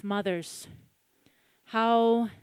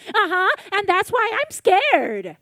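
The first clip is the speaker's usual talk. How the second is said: put-on high childlike whine; little girl voice; 9 semitones higher, 8 dB louder than usual. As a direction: answer like a small scared kid.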